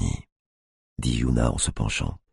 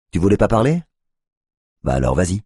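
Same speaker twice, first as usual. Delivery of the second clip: taunting; 6 semitones higher, faster than usual. A narrator's reading. Tiffany taunts the cop.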